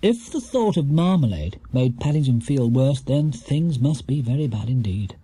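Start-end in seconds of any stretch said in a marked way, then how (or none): none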